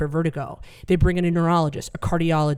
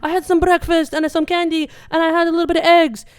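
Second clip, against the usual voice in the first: much higher and louder; high-pitched stoned dad; 11 semitones higher, 5 dB louder than usual. Retold or imitated. Comic voicing stoned dad listing breakfast, high and chirpy. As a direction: chirp it innocently. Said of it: "He's like".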